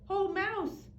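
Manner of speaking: high, bright greeting; cheery hare. Acted. Hare greeting mouse in the cave, cheery. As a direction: greet an old friend as a hare. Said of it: "says Hare".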